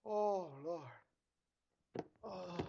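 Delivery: ordinary talk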